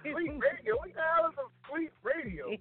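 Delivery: hamming up his confusion at the name; incredulous disbelief. Retold or imitated. Telling a friend about the invite, incredulous high disbelief.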